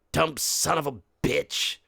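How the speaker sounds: ordinary talk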